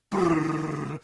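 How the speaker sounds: loud buzzing brrrr like a quivering blade; vibrating noise. Retold or imitated. Imitating the knife quivering in the counter, buzzing. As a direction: buzz it out like a shaking knife.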